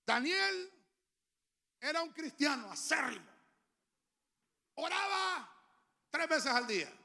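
high, loud preaching cry; preaching shout. Real, not acted. Pastor preaching about Daniel to the church, loud and high. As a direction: lift the crowd with it.

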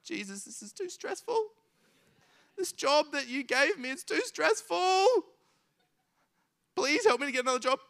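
high, pleading, half-crying complaint; whiny stressed pray-er. Retold or imitated. Stressed worker whining a prayer to Jesus, high and teary. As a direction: whine it like a worn-out employee begging God.

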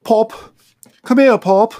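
high, loud cartoon English child; mock English accent. Child calling dad in England, mock English accent. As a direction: put on a silly, high-pitched English accent. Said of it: "call a dad in England".

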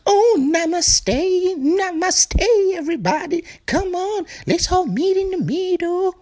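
very high, slow, airy hippie voice; mock namaste hippie. Drill sergeant mockingly trying to sound all namaste, high and dreamy. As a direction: float it like a dreamy peace-and-love hippie. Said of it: "can't try to be like".

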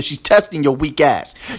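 ordinary delivery

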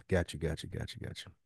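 normal talking voice

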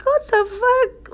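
very high, drawn-out comic disbelief; incredulous disbelief. Retold or imitated. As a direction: retell your shock in a squeaked-up pitch. Mom retells her shock at dad.

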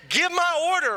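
hammed-up impatient customer demand; mock demand. Impatient customer at silent drive-thru.

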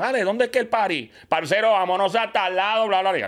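imitando con picardía a un amigo de Cali, en tono más agudo; imitación colombiana. Retold or imitated. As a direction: saludo de parcero en acento colombiano, más agudo. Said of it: "No, así de verdad". (imitando el acento colombiano de su pana DJ)

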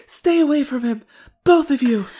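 loud, high mock-stage warning; theatrical stage voice. Podcaster as Rick's mother warning two people off, theatrical stage voice. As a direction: imitate an overacting protective mother. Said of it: "It sounds like a stage voice".

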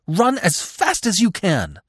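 high, drawn-out urgent call; urgent cry. A narrator's reading. Mother urging her fawn to flee, urgent cry. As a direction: cry out a desperate order. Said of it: "she cried".

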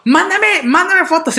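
high, eager and pushy; excited dad. Retold or imitated. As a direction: urge it like a thrilled father. Excited dad asking for photos.

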